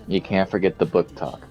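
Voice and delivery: usual speaking voice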